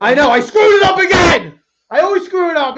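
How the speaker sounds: high, loud shout of frustration; yelling. Real, not acted. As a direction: shout out your own mistake at full voice. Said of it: "Stop yelling".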